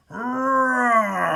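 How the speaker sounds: long growling animal roar; lion roar. A narrator's reading. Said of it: "In between the lion's roars".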